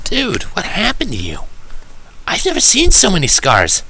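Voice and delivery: high, slow and worried, a hint of quiet; concerned tomboy pony. Tomboy pony asks her friend about his scars, high and hushed. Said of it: "inquired quietly".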